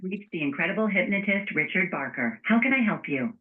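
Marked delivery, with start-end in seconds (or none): none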